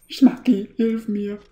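high, loud cry for help; panicked plea. Real, not acted. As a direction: beg for help, scared.

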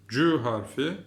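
ordinary delivery

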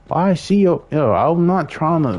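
high, loud outburst; real frustrated yell at the game; exasperated shout. Real, not acted. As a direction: cry out in disbelief. Game character does something wrong.

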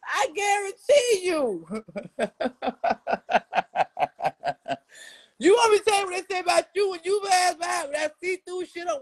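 high, laughing teasing shout; laughing taunt. Real, not acted. Teasing an older woman, laughing and high-pitched.

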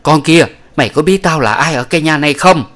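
loud, high, furious demand; barked question. Acted. Old woman shouting at the beaten concubine, loud and high. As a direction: roar the question down at her. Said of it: "bà mới quát hỏi".

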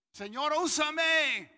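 booming, slow, high mock prayer; hammed-up cry to the Lord, drawn out; dangerous prayer. Preacher reenacting his risky prayer to God, booming mock plea. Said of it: "De las oraciones más peligrosas".

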